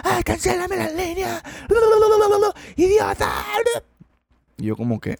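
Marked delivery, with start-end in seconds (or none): 0.0-3.3 high, shrill-pitched mock yell of an angry caller; high-pitched hammed-up yell; retold screaming customer. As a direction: imitate the caller screaming insults down the line. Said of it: "que me gritaron".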